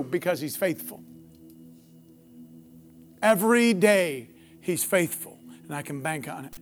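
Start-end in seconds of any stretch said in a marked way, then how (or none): none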